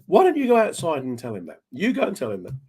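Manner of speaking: lightly acted indignant challenge; mock challenge. Retold challenge to the compere.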